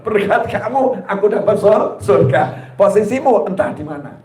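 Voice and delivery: normal talking voice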